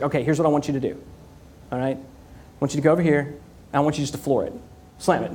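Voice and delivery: hint of a calm salesman giving orders; lightly voiced salesman. Voicing the salesman.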